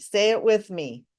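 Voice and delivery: ordinary talk, nothing marked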